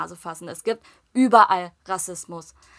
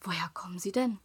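much softer, whispered; hushed curious question; 4 semitones higher, 9 dB quieter than usual. Retold or imitated. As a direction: lean in and ask in a hush. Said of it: "Und sie nur". (geflüsterte neugierige Frage)

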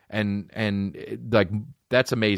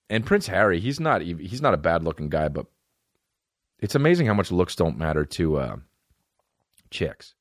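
ordinary talk

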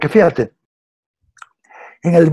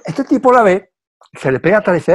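ordinary delivery

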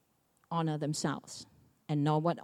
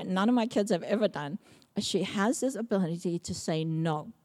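usual speaking voice

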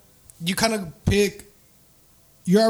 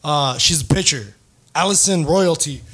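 ordinary delivery

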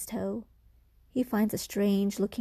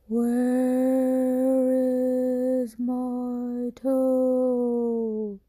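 slow, drawn-out ghostly moan, higher and louder; ghostly groan; 4 semitones higher, 6 dB louder, much slower than usual. Acted. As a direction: stretch every word like a ghost calling from the dark. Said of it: "it groaned".

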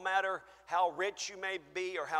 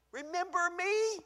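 pleading; 13 semitones higher than usual. Acted. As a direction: ask gently to be remembered. Acted plea, softer take.

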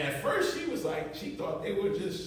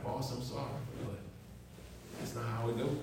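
calm correction; 7 semitones lower, 11 dB quieter, faster than usual. Retold or imitated. Correcting the boss calmly.

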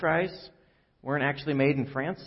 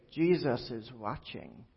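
hushed, drawn-out eerie voice; soft and slow, like an unseen voice in the dark; odd mysterious voice; 8 dB quieter, slower than usual. Acted. Unseen voice warning a burglar in a house, odd and hushed. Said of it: "odd voice say".

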